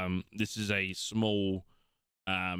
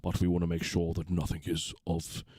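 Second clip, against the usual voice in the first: grave paladin; 2 semitones lower than usual. Acted. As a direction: speak with measured weight about the danger. GM voices paladin.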